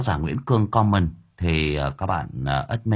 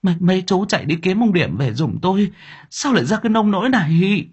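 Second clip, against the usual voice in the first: high, tearful pleading; mother crying and urging the workers to fetch her husband; sobbing plea; 10 semitones higher than usual. Acted. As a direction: beg the workers through tears. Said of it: "khóc nức nở".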